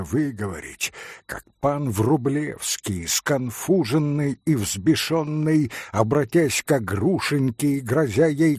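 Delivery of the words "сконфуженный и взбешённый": usual speaking voice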